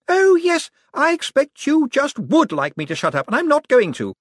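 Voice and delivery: high, loud, gloating heiress; gleeful haughty woman. A narrator's reading. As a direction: crow it with spiteful glee. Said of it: "said Pucci gleefully".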